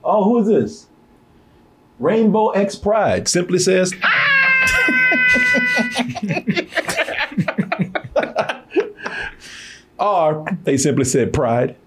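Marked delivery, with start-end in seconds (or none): 4.0-9.3 long shrieking high laugh; high laughing fit. Real, not acted. Streamer laughing at a chat name, high shriek. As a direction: burst into squealing laughter.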